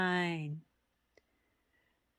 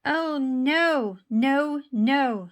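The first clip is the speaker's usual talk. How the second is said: higher, louder no no no; dismayed protest; 6 semitones higher, 8 dB louder, much slower than usual. Acted. Mister Pine objecting to his neighbours, loud dismayed no.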